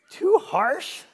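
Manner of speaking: high, loud mock disbelief; incredulous. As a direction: squeak it out in mock shock. Mocking incredulous question.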